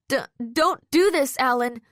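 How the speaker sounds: high, shaky frightened plea. A narrator's reading. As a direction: beg him with a trembling voice. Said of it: "my voice shaky".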